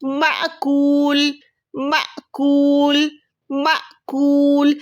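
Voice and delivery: माकूल drawn out slowly, each sound clear; slow recitation demo. Shown as a demonstration. Teacher demonstrates a word to students, slow recitation. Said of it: "झटके के साथ पढ़ेंगे".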